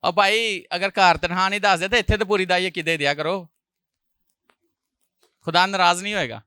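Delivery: ordinary talk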